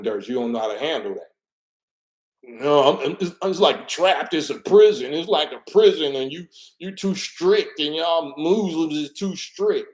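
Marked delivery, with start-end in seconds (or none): 2.4-9.9 raised, whiny woman's voice, higher; complaining woman. Retold or imitated. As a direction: play the wife griping that he's too strict.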